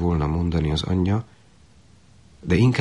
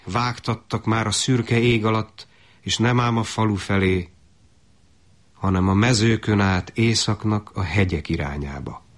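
usual speaking voice

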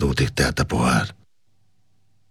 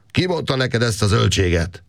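a much higher angry outburst at the soldier; angry shout; 10 semitones higher than usual. A narrator's reading. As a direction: snap at the soldier for spreading nonsense. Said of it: "kiáltott dühösen Káptalan".

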